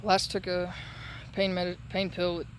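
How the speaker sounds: normal talking voice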